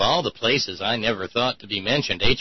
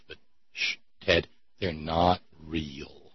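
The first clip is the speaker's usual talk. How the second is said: hushed; 7 semitones lower, 7 dB quieter, slower than usual. Pretend. Soothing Ted like a patient.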